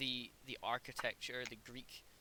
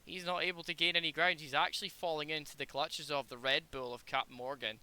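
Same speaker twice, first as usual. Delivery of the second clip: motorsport commentator; 7 dB louder than usual. Acted. Sim race play-by-play.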